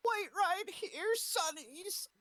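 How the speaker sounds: put-on high, slow old granny. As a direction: play a sweet little old lady. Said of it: "you have a great granny voice".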